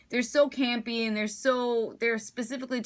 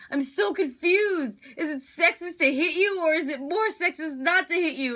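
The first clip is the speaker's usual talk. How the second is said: higher, mock-puzzled Deadpool line; higher, wide-eyed fake confusion; quoting Deadpool; 8 semitones higher, 3 dB louder than usual. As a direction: pitch it up and play it innocently confused. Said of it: "he's just like".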